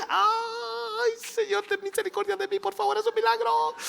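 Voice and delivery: very high, whimpering mock prayer; hammed-up anxious begging; mock desperate plea. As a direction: plead comically in a squeaky voice. Mocking a doubtful believer's panicked prayer.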